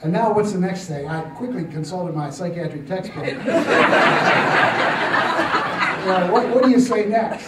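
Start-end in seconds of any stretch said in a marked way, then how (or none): 3.3-6.0 high, breathy laugh at his own joke. Real, not acted. Jokes about psychiatric textbook.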